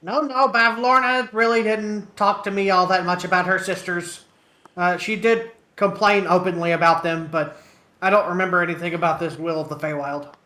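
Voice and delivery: loud, high put-on voice for Greetry; Greetry answering the party loudly; Greetry's voice.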